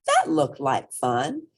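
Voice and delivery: ordinary delivery, nothing marked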